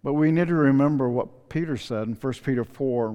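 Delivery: ordinary talk, nothing marked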